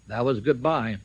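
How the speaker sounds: normal talking voice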